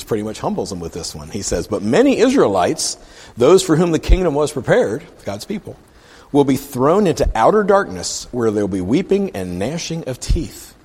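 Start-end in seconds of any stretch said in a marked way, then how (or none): 1.7-5.0 high, loud, forceful reading; proclaiming scripture. Acted. Jesus humbles God's chosen people, forceful proclaiming. 6.3-10.7 high, loud, forceful reading; proclaiming scripture. Acted. Jesus humbles God's chosen people, forceful proclaiming.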